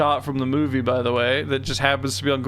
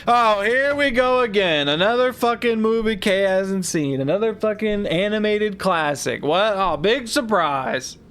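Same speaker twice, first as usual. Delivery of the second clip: higher-pitched sarcastic griping; mock exasperation; 6 semitones higher than usual. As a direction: imitate a fed-up complainer, pitched up. Mocking complaints about unseen classic films.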